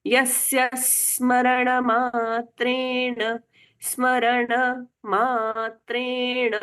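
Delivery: slow chant, मात्रेण ending in a full ण; full ण sound. Shown as a demonstration. Teacher showing the full ण, slow recitation. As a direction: sound the ण of मात्रेण fully, slowly. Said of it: "ण का पूर्ण उच्चारण हो रहा है".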